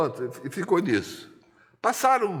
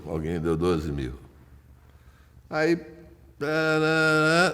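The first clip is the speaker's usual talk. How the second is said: ordinary talk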